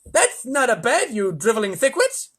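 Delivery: furious lisping President; angry yell. A narrator's reading. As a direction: yell the insult with a lisp. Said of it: "yelled the President".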